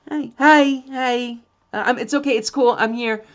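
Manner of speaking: put-on very high sing-song baby talk; cooing owner. Owner soothing a scared dog in very high baby talk. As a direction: coo at the dog to calm it. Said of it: "And then you're just like".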